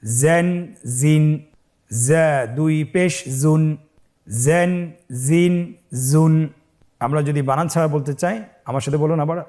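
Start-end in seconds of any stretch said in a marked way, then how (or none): 0.0-6.3 slow, drawn-out level chant; measured letter-by-letter chanting; recitation drill. Shown as a demonstration. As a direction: lead learners through each syllable, holding the vowels. Leads a spelling drill.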